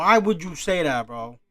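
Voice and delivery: loud, exasperated disbelief; exasperated outcry. Real, not acted. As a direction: burst out with the question. Same question again, out loud.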